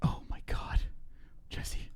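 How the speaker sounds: stunned whisper. Real, not acted. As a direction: breathe it out in shock. Shocked at photos.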